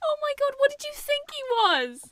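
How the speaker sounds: high-pitched squeal of disbelief; incredulous. Real, not acted. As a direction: gasp it out, astonished and very high. Amazed at co-host's confession.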